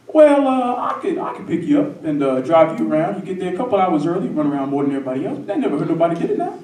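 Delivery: loud start, then fast patter; persuading football coach. Retold or imitated. Coach offers the boy rides, loud and hurried. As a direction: mimic a coach rattling off an offer. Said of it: "And he goes".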